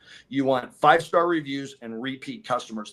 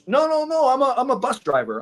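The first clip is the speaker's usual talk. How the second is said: much higher and louder mock customer voice; hammed-up eager bus driver; imitating the customer; 10 semitones higher, 5 dB louder than usual. Salesman imitating the bus driver's eager denial.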